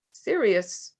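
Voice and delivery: ordinary delivery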